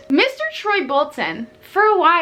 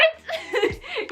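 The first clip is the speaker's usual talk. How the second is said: incredulous laugh; 6 dB quieter than usual. Real, not acted. Laughs at the absurdity.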